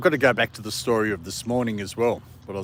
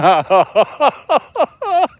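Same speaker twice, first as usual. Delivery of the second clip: loud high laugh; 14 semitones higher, 10 dB louder than usual. Real, not acted. Dog stole the hamburger.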